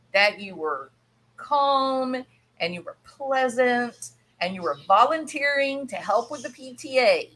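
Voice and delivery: ordinary talk, nothing marked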